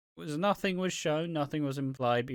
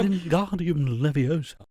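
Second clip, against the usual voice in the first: mock spell-casting; 3 semitones higher, 5 dB louder, slower than usual. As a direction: cast a mock wizard spell, slowly. Mock Harry Potter spell casting.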